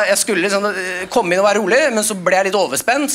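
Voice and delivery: normal talking voice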